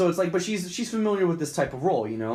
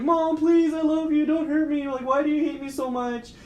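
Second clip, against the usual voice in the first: pleading son; 12 semitones higher than usual. Retold or imitated. The son begs his possessed mom, high and scared. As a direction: beg your possessed mother not to hurt you. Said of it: "And the son's like".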